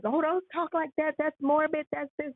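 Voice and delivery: a hammed-up pitch jump of discomfort; mock squeamish protest. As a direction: play the friend who says it's too morbid. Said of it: "We don't like".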